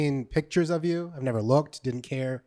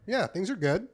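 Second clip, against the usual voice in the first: mouth full; 6 semitones higher, 4 dB louder than usual. Real, not acted. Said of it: "I got ice in my mouth".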